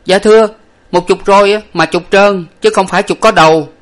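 high, loud servant protest; calling out loudly. A narrator's reading. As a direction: pipe up urgently at the master. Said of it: "nói lớn lên rằng".

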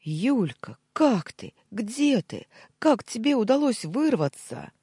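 high, loud, frantic questions; roaring. A narrator's reading. Former boss overwhelmed to hear from her, roaring. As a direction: roar the questions one after another. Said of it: "Кирилл буквально взревел".

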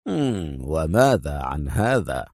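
low, slow, amused suggestion; playful. A narrator's reading. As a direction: offer it playfully, deep and unhurried. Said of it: "ابتسم أدهم في مرح".

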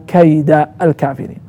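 كيد as kayda; fatha on the dal. Shown as a demonstration. Teacher showing the accusative, كيد ending in -a. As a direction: end كيد on an a before الكافرين. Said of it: "ونصب الدال".

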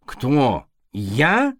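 indignant, rising; flaring up. A narrator's reading. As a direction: flare up in offense. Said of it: "вспыхнул кот".

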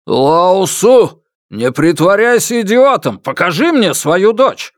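high, loud ultimatum; angry demand. A narrator's reading. Father orders the man to produce his daughter, angry demand.

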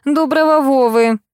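high, drawn-out angry retort; mild yell. A narrator's reading. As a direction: yell at him, but held back. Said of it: "заорала мать". (сдержанный гневный выкрик)

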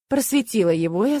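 usual speaking voice

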